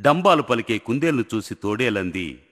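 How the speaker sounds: ordinary delivery, nothing marked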